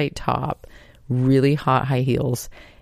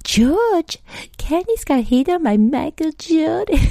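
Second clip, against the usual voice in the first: much higher, louder breathless announcement; excited shouting; 4 dB louder than usual. Retold or imitated. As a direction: yell the gossip across the house. Mom yelling news to dad.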